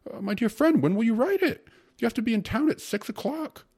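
very high, loud panic; dismayed. A narrator's reading. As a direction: plead frantically as they leave. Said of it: "asked the secretary in dismay".

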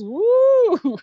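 high loud whoop; cheering woo. Acted. Mock cheer after egg hack.

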